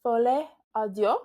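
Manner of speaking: put-on playful French accent on Folie à Deux. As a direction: say the title like a Frenchwoman. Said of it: "I hope y'all liked my French accents".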